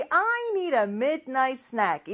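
slow, stretched-out teacher's English; drawn-out, evenly spaced words; slow model sentence. Shown as a demonstration. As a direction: model the sentence slowly for repeating. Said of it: "큰 목소리로 따라해볼까요".

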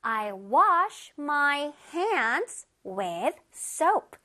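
I wash my hands with soap, each word drawn out for the class; very slow model reading. Shown as a demonstration. Teacher reads the example sentence slowly to the children. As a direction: read it slowly so children can follow. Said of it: "우리 한번 다시 읽어보자".

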